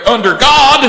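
hammed-up holy finale, shouted high; mock pious proclamation. Host mocking religious patriotism to listeners, hammed-up pious belting. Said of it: "Might have to start adding that "under God" part".

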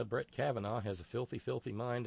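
ordinary talk, nothing marked

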